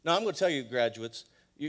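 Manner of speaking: ordinary talk, nothing marked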